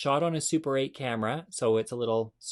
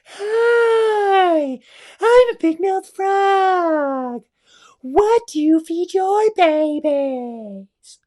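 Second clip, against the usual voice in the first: very high, very loud, slow squealing frog; big mouth frog voice; 13 dB louder, much slower than usual. Acted. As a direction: shout it like a huge-mouthed cartoon frog. Frog greets squirrel.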